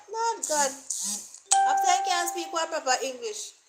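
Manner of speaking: high, scandalised voice of a critic; mock the listener who scoffs at bad English. Imitating a critic scoffing at the speaker's English. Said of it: "some persons who will be listening to you and they say".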